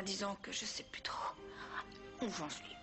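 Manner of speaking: whispering. Acted. Hesitant confession about love.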